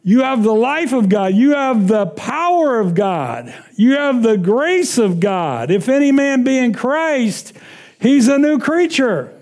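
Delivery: slow, rising preacher's cadence; preaching. Acted. Pastor preaching to the congregation, slow and rising. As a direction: preach it, stretching each phrase.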